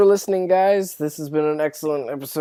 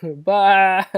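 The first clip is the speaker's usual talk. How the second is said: usual speaking voice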